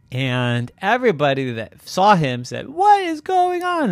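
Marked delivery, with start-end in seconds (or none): 2.7-3.9 high-pitched alarmed cry; scared onlookers. Acted. Onlookers seeing the headless llama, scared. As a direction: cry out in mock panic. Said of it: "They ran away scared".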